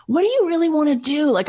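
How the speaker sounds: high, quick, eager question; acting out a keen young go-getter; Brian Grazer. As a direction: ask with wide-eyed enthusiasm. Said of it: "he was so enthusiastic and so cute".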